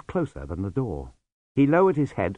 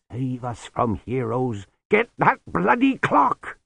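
slow, then high exasperated order; weary scorn, then command; 3 semitones higher, slower than usual. Acted.

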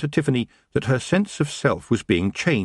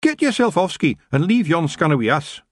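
high-pitched, feisty Scottish voice; eager little Scottish fighter; gruff-tempered Scots clansman; 8 semitones higher, 3 dB louder than usual. Acted. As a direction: send them off in broad Scots. Feegle's lines in story.

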